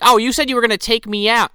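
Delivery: high, loud mock-wifely complaint; complaining wife. Wife complains to husband about going out, high mock complaint.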